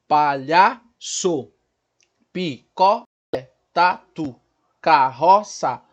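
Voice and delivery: palavras escandidas devagar, pausa entre as sílabas; separando sílabas. Shown as a demonstration. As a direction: cada sílaba como uma batida no peito. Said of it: "eu pedia pra eles baterem aqui no peito". (sílaba por sílaba, bem marcado)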